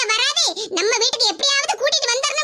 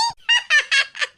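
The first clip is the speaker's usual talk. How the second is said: gleeful laugh, pitched up; triumphant laugh; 4 semitones higher than usual. Acted. Daughter-in-law laughs at fooling her mother-in-law, gleeful laugh. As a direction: laugh at having fooled her. Daughter-in-law's trick revealed.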